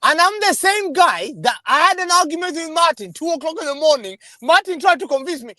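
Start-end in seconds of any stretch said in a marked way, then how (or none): none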